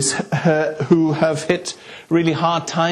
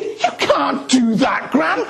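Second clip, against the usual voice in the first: put-on protesting boy; 11 semitones higher, slower than usual. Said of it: "And I'm thinking".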